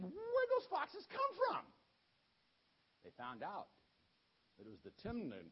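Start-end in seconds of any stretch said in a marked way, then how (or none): 0.0-1.6 much higher and louder, slow outraged yell; demanding to know who did it; angry villagers shouting. Retold or imitated. Angry villagers find burnt crops.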